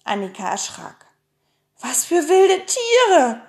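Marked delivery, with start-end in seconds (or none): 1.8-3.4 high, loud, drawn-out frightened question; trembling voice. A narrator's reading. Annika asks about the wild animals, trembling with fear. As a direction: ask in alarm, stretching the words slowly. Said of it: "fragte sie mit zitternder Stimme".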